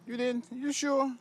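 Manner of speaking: high, sing-song voice for toddlers. Real, not acted. Father talks to toddler daughters.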